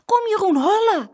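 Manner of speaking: high, loud urgent call. A narrator's reading. Girl calling her brother to run, urgent shout. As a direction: call out to get someone running. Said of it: "riep Saskia".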